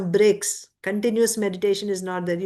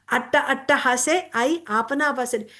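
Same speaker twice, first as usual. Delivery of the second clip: ordinary talk, nothing marked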